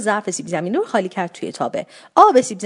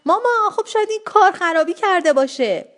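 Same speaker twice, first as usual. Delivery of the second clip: high-pitched, mildly raised call; acted yell; 9 semitones higher than usual. A narrator's reading. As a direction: yell to mother over the noise, lightly. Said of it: "داد زدم".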